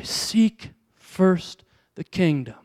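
each word slow and weighty, falling on first; slow emphatic scripture. Acted. Preacher urging the church, slow and weighty. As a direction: lay it down slowly like a promise.

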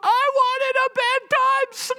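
high, slow, drawn-out mock wail; imitated child's tantrum. Son demanding a bedtime snack from dad, imitated tantrum wail. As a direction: howl it like a thwarted small child.

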